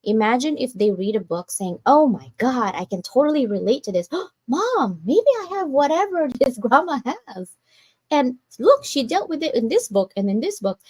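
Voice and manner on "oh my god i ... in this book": imagined excited descendant. Retold or imitated. Imagined great-grandkid reading the book.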